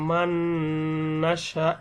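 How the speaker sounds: "মান্নাসা" drawn out slowly on a humming n; ghunnah. Shown as a demonstration. Teacher showing the noon takes ghunnah, long nasal hum. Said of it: "এই নুনটা কিন্তু এখানে একটা গুণনা হবে".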